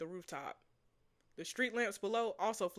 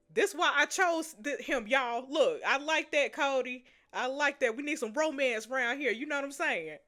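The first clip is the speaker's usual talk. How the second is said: giddy; 5 semitones higher, 6 dB louder than usual. Real, not acted. Gushing at the viewers.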